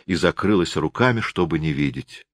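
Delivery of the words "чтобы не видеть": ordinary delivery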